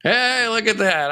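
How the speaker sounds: ordinary talk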